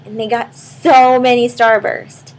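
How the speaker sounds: ordinary delivery, nothing marked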